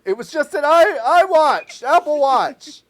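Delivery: high, loud, slow dying gasp; mock last words, strained and high; dying victim. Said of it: "And their last words are".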